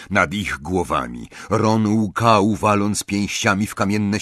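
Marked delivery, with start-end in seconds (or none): none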